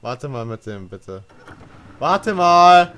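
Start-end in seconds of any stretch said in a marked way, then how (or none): 2.0-2.9 loud urgent call, slow at the end; shouting over machinery. Real, not acted. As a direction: yell to stop the engine. Yelling at driver to stop.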